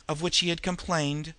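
ordinary delivery, nothing marked

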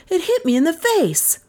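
high-pitched startled exclamation; startled cry. A narrator's reading. Beresford is hit by the flying intruder, crying out. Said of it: "cried Beresford abruptly".

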